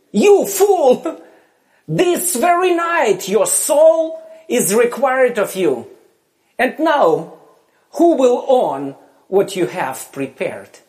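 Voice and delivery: loud, high rebuke; rebuking fool. Acted. God rebuking the rich fool in a loud voice.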